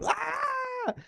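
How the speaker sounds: high thin imitated baby cry; mock baby wail. Baby crying at young mother, mock wail. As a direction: wail like a fussing infant.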